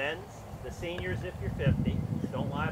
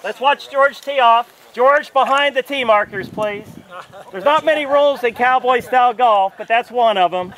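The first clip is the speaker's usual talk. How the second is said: loud, slow announcer voice; booming tee-box introduction; 7 semitones higher, 16 dB louder than usual. Acted. Announcing to the crowd.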